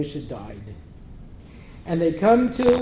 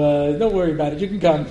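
normal talking voice

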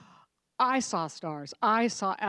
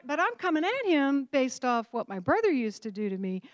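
ordinary delivery

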